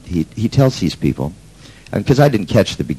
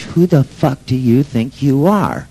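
retold yell; 7 semitones higher, slower than usual. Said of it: "this guy yells off of the stage".

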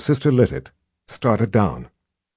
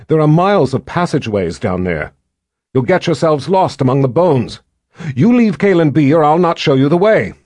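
put-on higher, louder girl's warning; defiant girl; 5 semitones higher, 4 dB louder than usual. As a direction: warn them firmly, high. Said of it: "Gillian slowly shook her head".

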